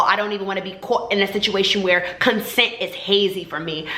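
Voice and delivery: usual speaking voice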